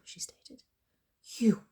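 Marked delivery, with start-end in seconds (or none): 1.2-1.6 a dry, breathless croak in a whisper; stunned, voiceless croak. A narrator's reading. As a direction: croak it out, shocked and barely voiced. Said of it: "I croaked".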